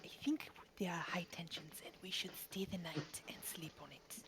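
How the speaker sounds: hushed unvoiced whisper. Aroi whispering to the group at the table. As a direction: whisper a calm suggestion. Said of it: "Aroi puts her coin down".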